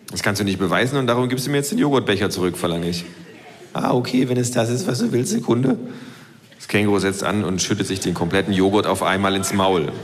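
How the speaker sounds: dry low self-voice. Acted.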